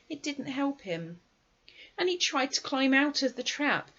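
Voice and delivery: plain reading voice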